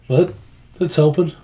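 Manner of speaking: ordinary talk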